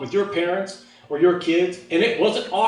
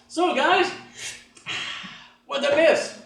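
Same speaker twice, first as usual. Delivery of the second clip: high-pitched, oblivious latecomer; cheerily clueless high voice; rational brain; 9 semitones higher than usual. Acted. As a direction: chime in high, as if just back. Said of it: "my rational brain finally comes back".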